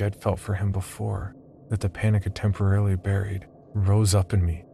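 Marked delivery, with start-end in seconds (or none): none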